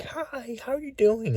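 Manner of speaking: very high, sing-song fake friendly hi; imitating the unsettling woman's greeting; eerie sweet greeting. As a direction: put on a creepy bright high voice. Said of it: "she was like".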